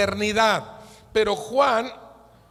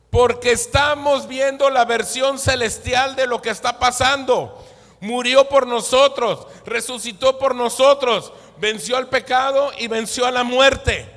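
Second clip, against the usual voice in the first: loud, raised victorious preaching; full-force preaching; 4 semitones higher, 6 dB louder than usual. Real, not acted. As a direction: proclaim the victory to the congregation, loud and high.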